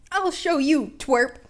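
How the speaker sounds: loud menacing villain; villain growl. Acted. Villain snaps back at the taunting boy. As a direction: snarl the threat back.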